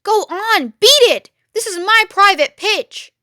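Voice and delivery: high, loud rat voice; high shrill rat, beat it shouted; angry rat. Acted. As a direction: shoo them off like a furious rat. Rat shooing the fox away.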